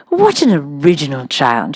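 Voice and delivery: lightly played simpering schoolmistress; Miss Minchin. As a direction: flatter the rich father, sweetly.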